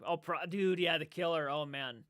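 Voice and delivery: normal talking voice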